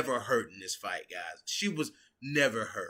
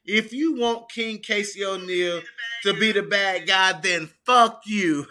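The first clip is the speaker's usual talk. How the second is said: louder, higher, slow punch on fuck; quoting Casey loudly; 6 semitones higher, 5 dB louder than usual. Retold or imitated. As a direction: deliver the bleeped line in full. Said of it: "I'll say what they bleeped out".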